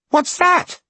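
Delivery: high, loud frightened shout; alarmed child cry. Acted. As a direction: cry out in the dark. Boy cries in sudden dark.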